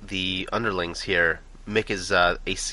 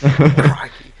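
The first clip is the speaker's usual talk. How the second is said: mock Aussie crikey; 6 semitones higher, 9 dB louder than usual. Echoing Australian exclamation.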